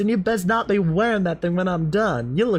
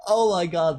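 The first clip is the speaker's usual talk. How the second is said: usual speaking voice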